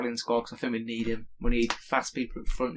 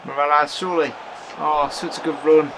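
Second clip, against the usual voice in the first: 1 excited call; 7 dB louder than usual. Real, not acted. Near goal chance.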